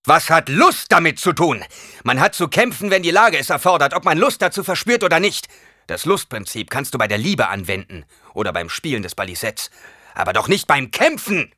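angry fencing master scolding his student. Acted. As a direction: tear into the lazy pupil. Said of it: "Hallecks Stimme klang sogar durch seinen Schild hindurch noch wütend".

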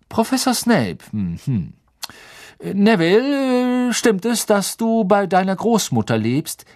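a friendly professor coaxing a shy pupil; Professor Lupin voice. Acted.

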